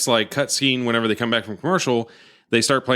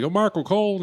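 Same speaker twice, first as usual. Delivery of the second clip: higher, lightly voiced mocking wrestler; mock taunt; 8 semitones higher than usual. JBL taunting Michael Cole in the ring, light mock taunt. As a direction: toss the name off with a smirk. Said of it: "he's just like".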